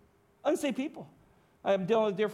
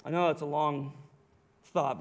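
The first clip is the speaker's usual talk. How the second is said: ordinary delivery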